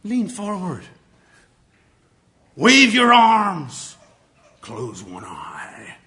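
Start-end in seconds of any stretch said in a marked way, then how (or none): 2.5-4.0 slow, loud, high; big, slow, loud delivery. Shown as a demonstration. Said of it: "Use kinesthetics". 4.6-6.0 slow, secretive whisper. Shown as a demonstration. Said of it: "Use kinesthetics".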